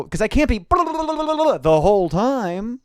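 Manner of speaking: high, stretched-out babble; drawn-out mock patter. Shown as a demonstration. Comic explaining their fast delivery, drawn-out mock patter. Imitates rapid patter, draws it out.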